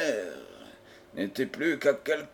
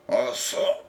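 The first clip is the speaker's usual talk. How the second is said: startled, slightly raised question; very surprised; 3 semitones higher than usual. A narrator's reading. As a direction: ask it in surprise. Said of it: "très surpris". (très surpris)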